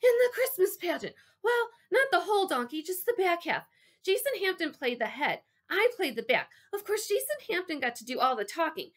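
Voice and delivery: put-on high-pitched rambling boy, no real whisper; desperate kid explaining himself. A narrator's reading. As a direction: gabble eagerly in a high, strained voice. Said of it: "it came out more like a desperate whisper".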